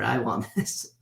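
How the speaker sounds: mock-greedy wanting, with a laugh; a playful grabby voice that breaks into a laugh; laughing through a mock want; laughing quote.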